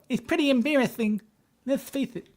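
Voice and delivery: pitched well up, with a lisp on the s sounds; high-pitched lisp. Retold or imitated. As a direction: turn "embarrassing" into "embarrathing" and "face" into "faith", voice up high. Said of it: "high-pitched lisp on that".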